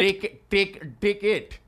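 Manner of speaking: "Tick, tick, ticket" with hard tapped Ts; tongue-smacked T. Shown as a demonstration. Comic drilling his partner, hard-tongued "ticket". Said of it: "have that tongue smack the top".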